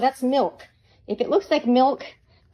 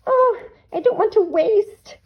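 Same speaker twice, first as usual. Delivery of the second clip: overly delicate crafter; 14 semitones higher, 5 dB louder than usual. Pretend. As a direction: tease the timid glue-savers with a prissy high voice. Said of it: "Some people just wanna be really delicate".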